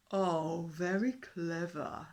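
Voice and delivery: loud, slow, mocking drawl; sarcastic. A narrator's reading. Dinosaur mocks the fish king's race, sarcastic. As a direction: sneer at the idea. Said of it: "said the dinosaur sarcastically".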